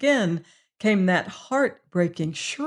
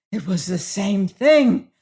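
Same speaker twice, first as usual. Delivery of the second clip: slow, louder frightened gasp; 4 dB louder than usual. A narrator's reading. Prisoner answering the warden, gasping in fear. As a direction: gasp it out, still afraid. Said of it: "gasped the prisoner".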